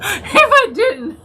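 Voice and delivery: high-pitched giggles breaking up the sentence; real helpless laughing fit; can't stop laughing. Real, not acted. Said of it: "I can't stop laughing".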